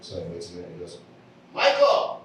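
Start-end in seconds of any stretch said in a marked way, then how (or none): 1.5-2.2 loud call across a theater. Acted. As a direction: holler for a friend across the room. Man calling second friend across theater.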